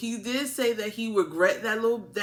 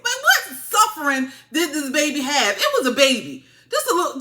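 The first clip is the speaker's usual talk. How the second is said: louder, raised-pitch scoffing; loud incredulity; 4 semitones higher, 5 dB louder than usual. Real, not acted. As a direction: protest it loudly, in comic disbelief. Scoffs at claim of baby's suffering.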